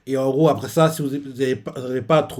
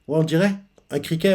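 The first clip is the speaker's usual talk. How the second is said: ordinary talk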